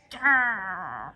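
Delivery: low mock growl. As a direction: growl like a grumpy principal. Krupp's angry growl.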